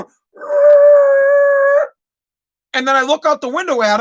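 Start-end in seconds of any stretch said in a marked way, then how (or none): 0.0-1.8 high, loud sustained truck rumble imitation; truck noise. Driver imitating his truck stopping, long loud high noise. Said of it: "and the truck's going".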